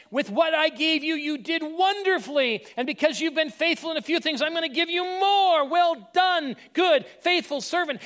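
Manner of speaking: high, loud, joyful master praising his servant. Acted. Master praises faithful servant, high delighted voice.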